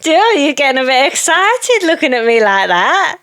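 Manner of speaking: high, slow, hammed-up teasing voice. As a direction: tease him in a big put-on girly voice. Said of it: "And then she's like".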